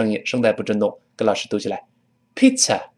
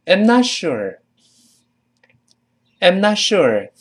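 higher, louder "I'm not sure" with NOT stressed; loud model read; 8 semitones higher, slower than usual. Shown as a demonstration. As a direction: say it loud for the class. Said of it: "我们大声跟老师读一遍". (大声领读)

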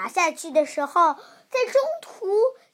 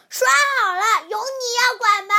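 higher, louder snapping back; angry retort; 6 semitones higher, 6 dB louder than usual. Acted. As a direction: brush off the meddler. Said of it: "他们俩就生气地说".

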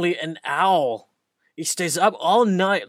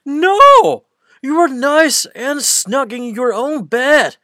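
much higher, loud fed-up no; exasperated refusal; 9 semitones higher, 8 dB louder than usual. Acted. Big Mouse refuses Little Mouse's request, loud and exasperated. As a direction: snap it shut, fed up.

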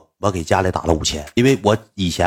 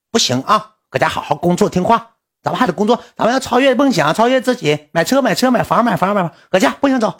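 much higher mock nagging assistant; assistant's high voice; 11 semitones higher than usual. Assistant telling the streamer to stay home and work, in a high mock voice. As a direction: squeal it like an excited motivator.

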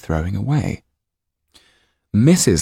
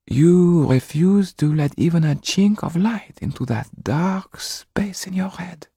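higher, sinking to a slow whisper; 12 semitones higher than usual. A narrator's reading. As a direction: coax him, fading into a hush. Wife continues pleading.

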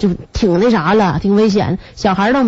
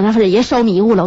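ordinary talk

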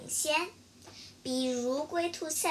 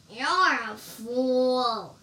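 scornful; 6 dB louder, much slower than usual. Acted. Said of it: "said the man with the axe".

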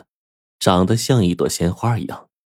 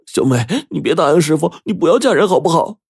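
much higher, louder, fast tearful plea; crying plea; 11 semitones higher, 4 dB louder, faster than usual. Acted. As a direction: beg her through tears. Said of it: "哭着说".

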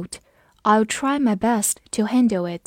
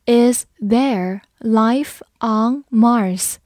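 very slow question, each word drawn out; slow read; 4 dB louder, much slower than usual. Shown as a demonstration. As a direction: read slowly so they can follow. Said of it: "跟着我慢读一遍". (放慢速度让学生跟读)

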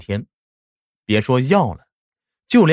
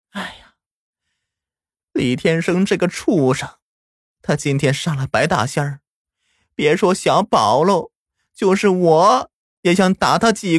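tearful; 8 semitones higher than usual. A narrator's reading. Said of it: "奶奶却流着眼泪说道".

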